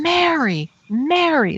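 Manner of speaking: high, drawn-out mock scream of a name; imitating a woman shrieking; retold scream. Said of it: "she started screaming".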